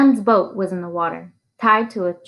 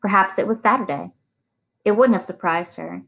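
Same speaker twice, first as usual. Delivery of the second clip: ordinary delivery, nothing marked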